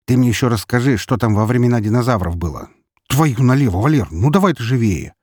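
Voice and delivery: fed-up, emphatic prodding; impatient sarcasm. A narrator's reading. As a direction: hurry a rambling storyteller along. Impatient friend hurries the storyteller.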